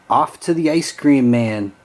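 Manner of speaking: plain reading voice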